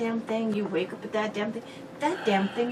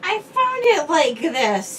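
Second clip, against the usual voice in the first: mock innocence; 7 semitones higher, 11 dB louder, much slower than usual. Denying breaking the figurine.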